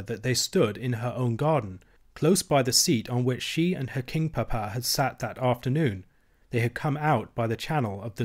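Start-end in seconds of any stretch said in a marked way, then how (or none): none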